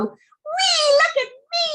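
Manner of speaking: high, thrilled whee from the swing; gleeful squeal. Acted. Boy on the swing crowing to his sister, high and gleeful. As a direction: squeal with joy like a kid on a swing.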